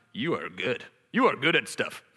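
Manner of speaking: smug inner voice, lightly done; preacher imitating his pride. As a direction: pat yourself on the back. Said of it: "pride voice in my heart".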